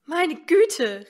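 a shocked mother's outburst; exclaiming mother. Acted. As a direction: gasp it out like a worried mother. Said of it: "rief Mutter".

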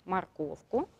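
usual speaking voice

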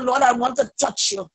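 normal talking voice

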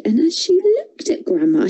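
ordinary delivery

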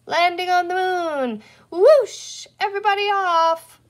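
put-on high, loud child at play; excited play-acting. A narrator's reading. Boy plays rocket with his tractor, excitedly calling out. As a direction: announce a pretend landing with a big whoosh.

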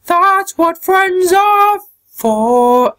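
put-on high-pitched, slow tough girl line; high character voice. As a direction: stretch it out in the fighter's voice. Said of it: "I can't do it with their voice".

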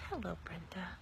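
hushed private repeat of the greeting; whispered aside. Real, not acted. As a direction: mutter it under your breath to the camera.

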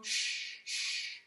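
soft unvoiced hush; hushing shh. Shown as a demonstration. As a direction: hush the snow so it doesn't fall. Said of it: "And we sh, sh, two times".

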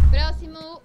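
loud bark for the next traveller; officious call. Acted. Customs officer loudly calling the next traveller.

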